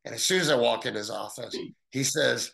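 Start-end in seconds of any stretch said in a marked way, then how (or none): none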